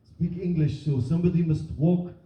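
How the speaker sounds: halting English in a put-on Syrian accent; mock foreign accent. Acting a Syrian family deciding who walks to Dover, foreign accent. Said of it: "unclear why her accent is so different".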